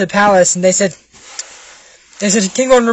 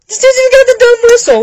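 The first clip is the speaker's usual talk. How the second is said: very high, squeaky and fast; high mocking squeal; 3 dB louder, faster than usual. Mocking the dude with the dumb whistle, high squeal. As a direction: race through it in a squeaky pitch. Mocking the character who blamed others.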